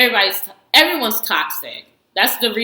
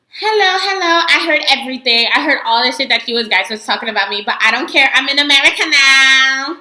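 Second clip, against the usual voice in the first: mock defiant entrance; 6 semitones higher, 5 dB louder than usual. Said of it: "And then she's just like".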